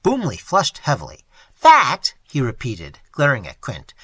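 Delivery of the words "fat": loud, higher outraged question; offended. A narrator's reading. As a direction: bristle at the word. Boomley offended, flushing.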